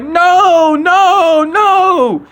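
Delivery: very high, loud wailing "No, no, no"; mock crying kid. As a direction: wail like a kid caught and scared of his dad.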